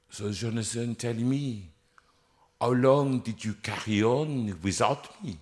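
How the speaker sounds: put-on soft, deliberate French-accented question; weak conductor with a French accent. Collapsed conductor asking singer about the performance, soft French accent. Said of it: "in a French accent".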